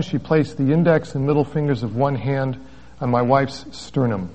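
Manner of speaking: ordinary talk, nothing marked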